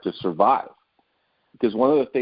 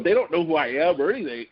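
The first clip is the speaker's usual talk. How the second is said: ordinary talk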